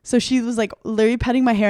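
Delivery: ordinary delivery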